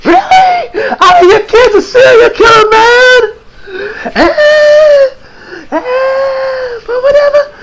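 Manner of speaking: very high, hammed-up crying shout; mock wailing outrage. Reacting to the father's speech with mock wailing shouts. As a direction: wail in fake disbelief.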